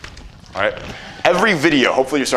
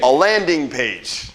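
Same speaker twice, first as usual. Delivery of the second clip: LANDING stretched slowly and pitched up; "landing page" drawn out grandly, LANDING raised; drawn-out emphasis; 4 semitones higher, much slower than usual. Shown as a demonstration. Coach showing the class how to say it, drawn-out emphasis on LANDING. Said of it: "You gotta say it like that".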